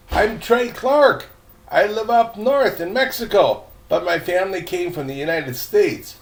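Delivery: put-on a nervous boy answers the ghost girl; lightly voiced nervous boy. Boy introduces himself to ghost.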